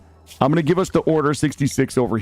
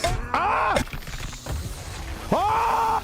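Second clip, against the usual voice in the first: high, panicked screams; short bursts of high screaming; screaming for dear life; 5 dB quieter than usual. Real, not acted. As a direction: shriek as if fighting for your life. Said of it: "I'm screaming for our lives".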